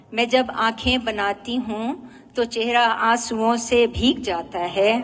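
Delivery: measured nazm recital, each phrase set apart; poetry recitation. Acted. Poet presenting a nazm about drawing eyes, poetry recitation. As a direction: recite line by line with lingering endings.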